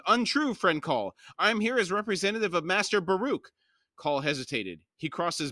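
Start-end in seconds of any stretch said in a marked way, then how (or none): 0.1-3.5 higher-pitched, indignant protest; protesting exclamation. A narrator's reading. Krupp protests to Cole that he represents Baruch, exclaiming. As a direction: exclaim it in a lifted voice. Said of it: "Krupp exclaimed".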